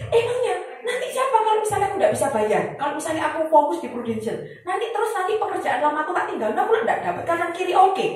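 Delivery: high laughing mock protest; laughing mock complaint. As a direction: whine it out high through giggles. Continues retold rant.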